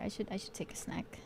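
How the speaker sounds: quick hushed whisper. Real, not acted. Streamer whispering while sneaking a snack.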